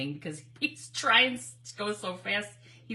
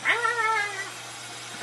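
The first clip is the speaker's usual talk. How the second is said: high, loud yappy barks; imitating small dogs barking; 12 semitones higher, 5 dB louder, much slower than usual. Telling viewers how her small dogs bark, high, loud yapping. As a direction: yap like a tiny dog. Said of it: "my little ones are like".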